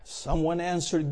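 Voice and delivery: ordinary talk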